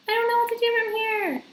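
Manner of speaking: high-pitched mock-confused viewer voice. Vlogger imitates a lost viewer, high mock-confused whine. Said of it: "so you're not like".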